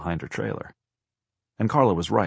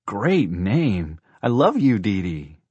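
put-on higher, louder, swooping child's delight; gushing child; 3 semitones higher, 6 dB louder, much slower than usual. A narrator's reading. Girl tells the horse she loves her, gushing. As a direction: gush with big swoops on the words.

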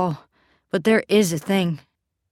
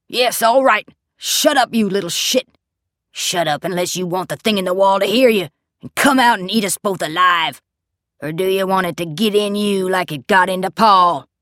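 loud, furious, raised bellow; 6 dB louder than usual. A narrator's reading. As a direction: roar up from downstairs. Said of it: "he bellows out of the dark".

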